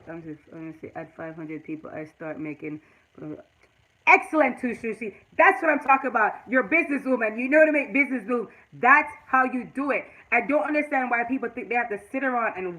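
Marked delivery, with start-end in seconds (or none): 0.1-2.8 reading to herself. Real, not acted. Reading a chat comment aloud. 4.0-10.0 enthusiastic praise. Real, not acted. Praising a viewer in chat.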